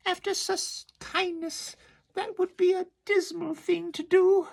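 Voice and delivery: very high squeaky cry; tiny oysters crying out in alarm; frightened oysters. Acted. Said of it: "the oysters cried".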